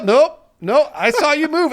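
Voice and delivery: high, loud indignant wizard voice; mock Ollivander. Ollivander scolding the thirty-year-old, high and loud. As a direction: act scandalized, up high. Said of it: "Ollivander voice continued".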